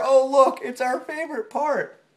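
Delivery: ordinary delivery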